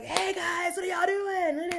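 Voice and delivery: retelling her carefree younger self, laughing and calling out; goofy happy greeting. Retold or imitated. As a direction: bounce in like nothing ever hurt you. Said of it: "Like, I was goofy, happy".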